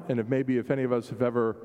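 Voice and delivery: normal talking voice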